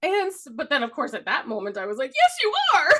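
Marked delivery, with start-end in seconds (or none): 2.1-2.9 high, loud comic wail; hammed-up tearful retort; mock bawling. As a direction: wail it back like a crybaby.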